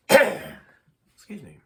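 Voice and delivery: ordinary delivery, nothing marked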